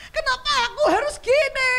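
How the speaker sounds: screaming victim. Acted. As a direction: cry out why me. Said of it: "mereka teriak-teriak". (teriakan korban yang putus asa)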